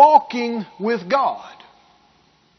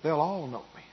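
much softer and lower, falling away; soft repeat; 5 semitones lower, 9 dB quieter than usual. Real, not acted. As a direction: let it sink in quietly. Soft repeat after congregation responds.

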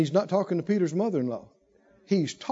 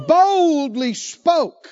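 high, loud-leaning, long-held BOLDLY; bold preaching; 8 semitones higher, 6 dB louder, much slower than usual. Shown as a demonstration. Preacher urges congregation to command ailments, bold and slow. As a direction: proclaim it slowly and high. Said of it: "Wonder if you boldly spoke".

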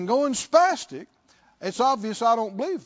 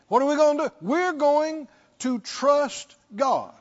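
rising preacher call; 6 semitones higher, 4 dB louder than usual. Acted. Preacher answers the panic cry.

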